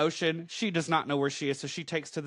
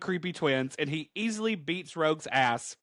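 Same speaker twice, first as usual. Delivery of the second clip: normal talking voice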